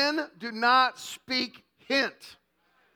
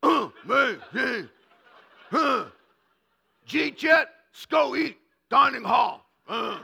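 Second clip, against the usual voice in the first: grunting caveman; slower than usual. Retold or imitated. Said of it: "A man barely speaks cave".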